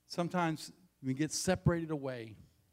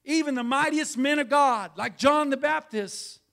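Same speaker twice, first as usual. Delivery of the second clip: high and loud, hammering every phrase; full-force preaching; 11 semitones higher, 11 dB louder, slower than usual. Acted. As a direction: shout it out in disbelief at John. Preacher peaks into full-force exhortation.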